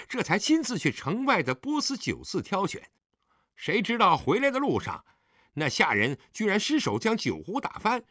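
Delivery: hearty, high-pitched son explaining himself; 爽朗笑道. A narrator's reading. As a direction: explain it away breezily, voice lifted. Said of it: "狄景辉爽朗地笑道". (爽朗高扬地向父亲解释)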